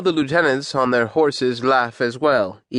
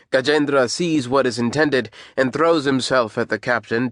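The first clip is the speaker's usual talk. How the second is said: ordinary delivery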